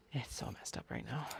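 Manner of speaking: soft breathy aside; whisper. Real, not acted. Telling listeners the book's tension is unbearable, whispered. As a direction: confide it under your breath. Aside about slow-burn romance.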